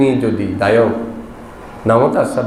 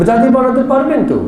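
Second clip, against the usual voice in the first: a much higher, lifted voice for the monk; monk's teasing question; 12 semitones higher than usual. Acted. Monk teasing the worried devotee, high and light.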